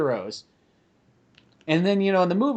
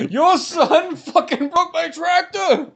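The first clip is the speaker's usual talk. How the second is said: angry farmer; 13 semitones higher, much slower than usual. Retold or imitated. Retold angry farmer suing.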